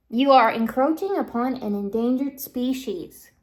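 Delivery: loud, stern reprimand; shouted official warning. Acted. Sheep protector warns intruders off an endangered species, shouted scolding. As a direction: project every word like a public warning.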